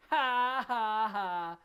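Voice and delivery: loud, spelled-out mock ha ha ha; mocking laugh. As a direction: laugh in their face. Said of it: "Like, basically like that".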